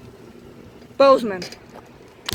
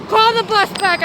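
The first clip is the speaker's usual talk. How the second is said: raised, insistent order to call the bus back; angry demand; 6 semitones higher, 4 dB louder than usual. Retold or imitated. Student yelling at office staff to recall bus. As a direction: demand it loudly, fed up.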